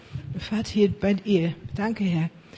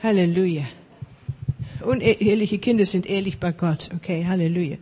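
ordinary talk

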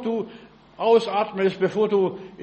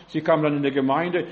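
usual speaking voice

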